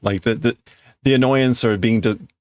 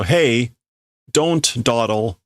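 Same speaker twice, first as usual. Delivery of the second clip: normal talking voice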